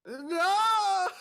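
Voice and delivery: high, very loud squealing protest; shrieking no. Acted. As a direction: shriek it as you're dragged away. Pulled onto the court.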